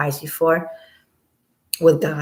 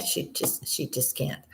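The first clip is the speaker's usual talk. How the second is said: exasperated whisper; hushed, shaking your head; 2 semitones lower, 12 dB quieter than usual. Real, not acted. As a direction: let it die under your breath. Trails off in exasperation.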